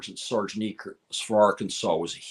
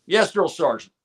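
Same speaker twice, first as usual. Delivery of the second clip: crisp, high recruit answer; barked military recruit reply; 7 semitones higher, 4 dB louder than usual. Retold or imitated. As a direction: snap back like a private. Recruit answers drill sergeant.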